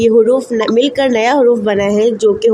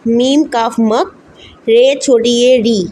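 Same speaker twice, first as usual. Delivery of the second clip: मक and री spelled slowly letter by letter; slow spelling out; slower than usual. Shown as a demonstration. Teacher slowly spelling मकरी for students. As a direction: sound it out slowly for beginners. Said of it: "तो इसको हम पढ़ेंगे कैसे".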